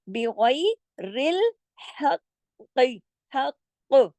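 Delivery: "बिगैरिल हक" slowly, thin b, heavy ghain; recitation demo. Shown as a demonstration. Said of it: "तो हो जाएगा हक".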